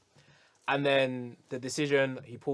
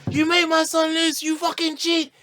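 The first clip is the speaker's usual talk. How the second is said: retold angry yell; 6 dB louder than usual. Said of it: "He's like".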